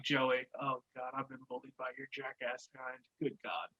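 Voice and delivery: low, very soft imitated grumble; grumbling aside. Co-host playing Chris, who is not cool with Joey, muttered grumble. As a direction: mutter it under your breath, put-upon.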